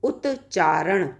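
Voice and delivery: drawn out slowly, each syllable of उत्चारण clear; teacher saying उत्चारण at half speed; slow demo. Shown as a demonstration. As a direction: spell out उत्चारण slowly for students. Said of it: "जैसे हमने कहा".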